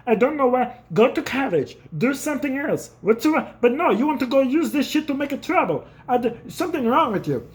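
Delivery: high mock frustrated dad; hammed-up baffled father; dad telling his son to go to college; dad lecturing. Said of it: "He's like".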